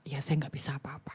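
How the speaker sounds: whispered, giving up; resigned whisper. Retold or imitated. Husband tells the boss he can do nothing, whispered. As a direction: breathe it out like a helpless husband.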